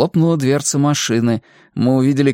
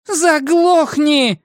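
high, drawn-out shout; enraged woman's screamed order; furious yell; 3 dB louder, much slower than usual. A narrator's reading. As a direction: yell it high, stretching the word. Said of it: "проорала она".

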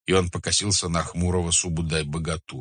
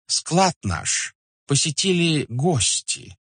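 careful, ingratiating complaint with lifted phrases; deferential merchant; 5 semitones higher, 4 dB louder than usual. Acted. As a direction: complain politely, weighing each phrase. (вкрадчивая, почтительная жалоба купца)

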